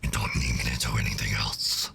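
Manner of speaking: very low, distorted demon voice; demonic voice. Pretend. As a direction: growl it like a demon from below. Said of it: "in demonic voice for?".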